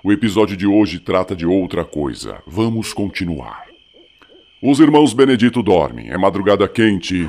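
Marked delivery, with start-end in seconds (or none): 4.6-7.3 story narration. A narrator's reading. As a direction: narrate it again calmly.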